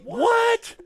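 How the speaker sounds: high, loud startled "What?"; startled shout. Retold or imitated. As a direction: bark it out, caught off guard. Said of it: "And he's like".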